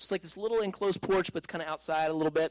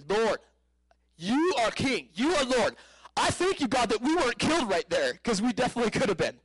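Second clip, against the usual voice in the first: higher, emphatic cry to God; raised-pitch, fervent praise; fervent prayer; 6 semitones higher than usual. Retold or imitated. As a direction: reenact crying out thanks to the Lord. Reenacts praying aloud after the blast.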